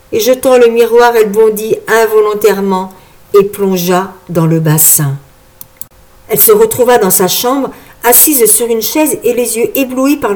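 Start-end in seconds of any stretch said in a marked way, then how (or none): none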